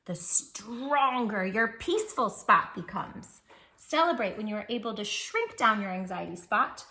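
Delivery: plain reading voice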